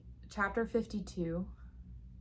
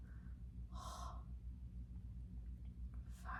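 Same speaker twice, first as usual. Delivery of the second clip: long, breathy swoon sigh; dreamy sigh; 16 dB quieter than usual. Real, not acted. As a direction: sigh like you're melting. Swooning after romantic line.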